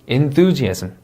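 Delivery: "enthusiasm" slow with a marked stressed syllable; careful word stress. Shown as a demonstration. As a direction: stretch the stress on THU in "enthusiasm". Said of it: "강세 주의에서 한번 단어 읽어볼게요". ("enthusiasm" 강세 살려 천천히)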